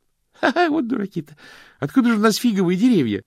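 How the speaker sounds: triumphant as if he found a flaw in dogma; laughing exclamation. Acted. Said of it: "воскликнул Стэнли". (Стэнли со смехом восклицает)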